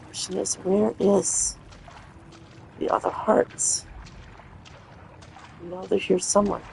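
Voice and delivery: whispering while sneaking; hushed whisper. Real, not acted. Searching quietly near the monster.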